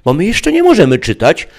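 put-on high, loud little girl's voice; a child reciting the rule; childlike Eve. A narrator's reading. Eve repeating father's rule. (wysoki, głośny głosik dziecka)

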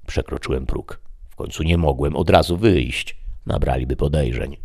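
usual speaking voice

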